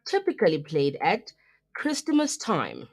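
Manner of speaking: ordinary delivery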